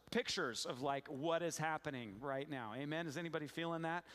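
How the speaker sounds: ordinary talk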